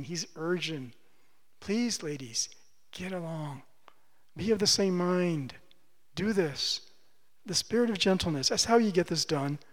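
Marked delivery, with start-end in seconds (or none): 1.6-3.6 soft, tender plea to the ladies; gentle pleading. Retold or imitated. Paul pleading with the two ladies, softly. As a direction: coax them kindly, barely above a whisper. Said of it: "He's pleading".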